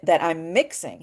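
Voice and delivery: ordinary talk, nothing marked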